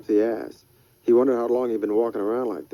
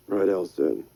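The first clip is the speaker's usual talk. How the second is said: ordinary delivery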